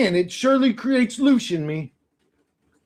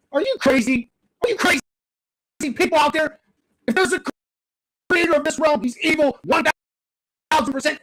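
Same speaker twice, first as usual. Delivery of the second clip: higher-pitched, heated outburst at humanity; angry rant; 7 semitones higher than usual. Real, not acted. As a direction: vent real frustration, pitch climbing. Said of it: "I got mad for a second there".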